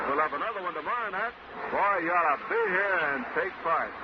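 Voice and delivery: usual speaking voice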